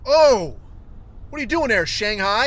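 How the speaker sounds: sudden loud, high mock outburst; zero to one hundred. Grandfather confronts the Japanese exchange student, imitated angry outburst. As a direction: imitate a grandfather blowing up instantly. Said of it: "like, zero to one hundred".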